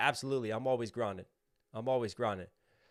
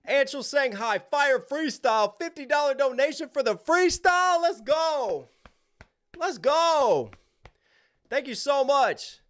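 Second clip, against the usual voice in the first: hyped shout-out; 11 semitones higher, 5 dB louder than usual. Real, not acted. Hyped shout-out for fifty dollar donation.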